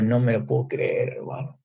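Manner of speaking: dying inside at the giraffe suit; crushed disbelief. Retold or imitated. Finds the giraffe costume. (incredulidad abatida, lenta y baja)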